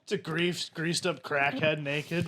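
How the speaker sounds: ordinary talk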